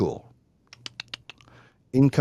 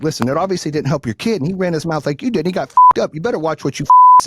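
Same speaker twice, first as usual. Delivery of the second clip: angry threat; 3 semitones higher, 6 dB louder than usual. Retold or imitated. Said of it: "I was like".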